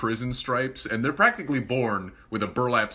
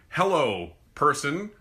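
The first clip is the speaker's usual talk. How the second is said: mild mock yell; 6 semitones higher, 5 dB louder, slower than usual. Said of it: "If I yell across a room".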